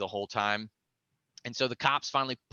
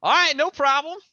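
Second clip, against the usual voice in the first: high, louder, easygoing reply; breezy agreement; 7 dB louder than usual. Retold or imitated. Him agreeing to wait, high and breezy. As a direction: toss it off carefree. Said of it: "And I'm like".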